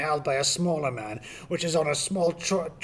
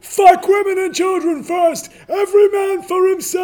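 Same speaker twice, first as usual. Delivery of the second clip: panicked high shout; 13 dB louder than usual. Acted. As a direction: bellow it as the ship sinks. Ship sinking panic.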